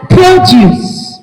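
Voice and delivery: "produce" as PRO-duce, first syllable high; noun pronunciation. Shown as a demonstration. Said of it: "different ways to pronounce this".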